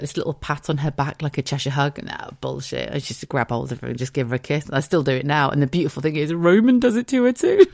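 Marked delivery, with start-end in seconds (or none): 6.3-7.7 higher, louder, gleeful; delighted punchline. Real, not acted. To listeners about her son hugging Bex, gleeful. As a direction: crow it with delight.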